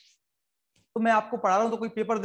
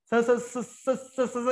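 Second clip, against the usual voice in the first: stammering friend; 3 semitones higher, slower than usual. Retold or imitated. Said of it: "मतलब इतने हकलाते हैं".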